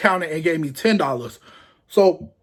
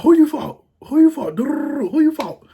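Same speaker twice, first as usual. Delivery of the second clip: high loud demand that slows and fades; demanding shout, fading; 9 semitones higher, 4 dB louder, slower than usual. Retold or imitated. Cousin Jerry pressing who he fought, loud then fading. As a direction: repeat it, losing volume.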